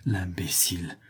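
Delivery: breathy whisper of "L'imbécile!", words barely voiced; whispered thought. A narrator's reading. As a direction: hush "L'imbécile!" to yourself in disbelief. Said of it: "un besoin de rire le saisit et il pensa".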